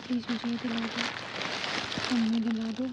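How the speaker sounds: slow, drawn-out begging to mom; childlike pleading. Retold or imitated. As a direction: whine it slowly like a kid begging. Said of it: "मैं mummy को बोल रही हूँ". (धीमी आवाज़ में बच्चों जैसी मिन्नत)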